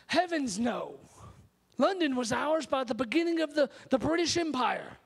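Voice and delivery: indignant English leader in a put-on British accent; hammed-up British accent, proud of the empire. English leader defending London to young David, in a British accent. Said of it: "That's my best British accent".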